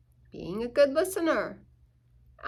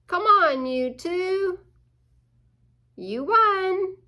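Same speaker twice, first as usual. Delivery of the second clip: high, louder cheer; 8 semitones higher, 5 dB louder than usual. Acted. Reader cheering playing otters, high and excited.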